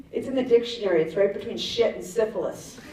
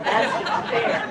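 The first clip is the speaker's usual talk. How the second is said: high, slow whiny protest; mock whine; 10 semitones higher, much slower than usual. Comic protesting wrinkles and zits, slow whine. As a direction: pout it like a kid. Mock complaint about aging.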